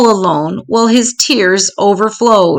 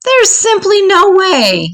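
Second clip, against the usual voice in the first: donkey's voice jumps much higher, slowed and pleading; high-pitched dread; 12 semitones higher than usual. A narrator's reading. Little donkey to the tall man, high slow dread. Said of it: "cried the donkey in, with dread".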